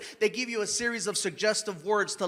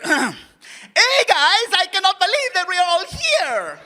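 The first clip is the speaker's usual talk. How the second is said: much higher and louder accent; heavy Mexican accent; 8 semitones higher, 6 dB louder, slower than usual. Shown as a demonstration. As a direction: double the spice on the Mexican accent. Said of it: "give me more energy and double the spice".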